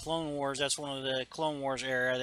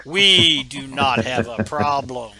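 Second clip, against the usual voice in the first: menacing Vader; 2 semitones lower, 11 dB louder, slower than usual. Retold or imitated. Vader warning his officer, slow and menacing. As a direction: lay down the threat slowly.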